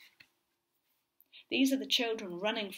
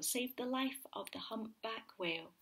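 usual speaking voice

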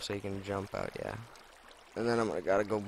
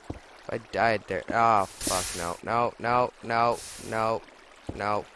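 ordinary talk, nothing marked

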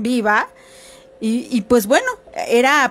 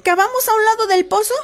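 put-on high, raised kids' voices calling; children calling out; 10 semitones higher, faster than usual. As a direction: call down urgently to the buried woman. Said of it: "a decir".

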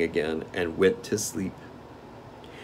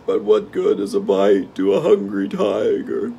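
hungry tiger; 6 dB louder than usual. Acted.

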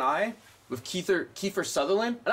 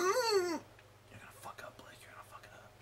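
whispering; 14 semitones higher, 8 dB quieter than usual. Acted. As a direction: hiss it like a mocking inner voice. Taunting himself as an inner voice.

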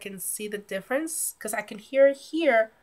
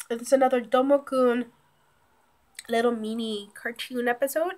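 ordinary delivery

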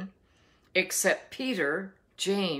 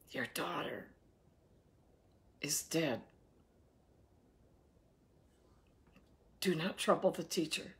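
hushed bad news; 2 semitones lower, 8 dB quieter than usual. Messenger brings news of death.

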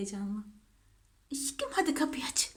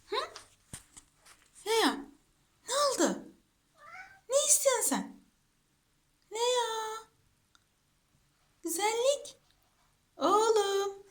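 high cooing voice; 8 semitones higher than usual. Real, not acted. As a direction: coo questions at a beloved pet. Cooing to whining pet dog. (tiz, bebekle konuşur gibi)

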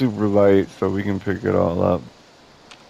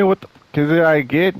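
mock outrage; 8 semitones higher, 4 dB louder than usual. Haggling with sad robot.

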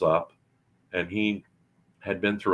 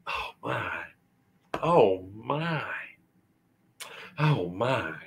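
gasping in awe; 3 semitones higher, 3 dB quieter, slower than usual. A narrator's reading.

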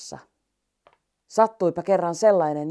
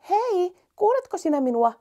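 calling out; 13 semitones higher than usual. Acted. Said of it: "hiirulainen huuteli".

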